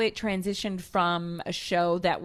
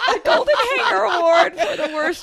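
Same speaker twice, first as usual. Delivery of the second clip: high, loud laughing through the words; 11 semitones higher, 10 dB louder than usual. Real, not acted. Laughing at her own worst-dressed award, loud and high.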